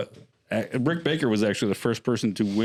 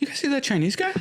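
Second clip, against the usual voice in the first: [name] impression. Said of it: "[name] impressions".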